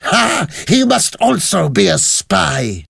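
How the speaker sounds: high, gloating "Ha!" then accusation; high-pitched triumphant scorn; scornful laugh. A narrator's reading. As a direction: accuse with mocking glee. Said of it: "The Vengeance laughed and said".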